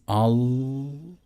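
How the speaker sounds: very slow, drawn out on the in-breath; slow guided breath count toward Al; slow breathing demo. Shown as a demonstration. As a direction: stretch each word like a long inhale. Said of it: "you're going to breathe in with the first syllable".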